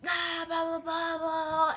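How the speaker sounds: high, droning blah-blah imitation; put-on high whine, running on; mock griping. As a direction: mimic nonstop nagging on one high note. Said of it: "still bitching at me".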